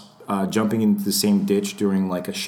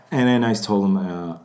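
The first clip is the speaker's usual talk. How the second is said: ordinary talk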